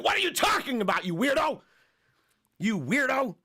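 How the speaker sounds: hammed-up baffled shout; mock exasperated outburst. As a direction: yell it like you can't believe this guy. His mocking reply to Coffin.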